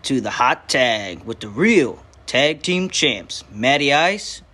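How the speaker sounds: loud, slow, hyped radio announcer read; announcer intro. Acted. Host opening the wrestling podcast, big announcer voice. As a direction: boom it out like a show open.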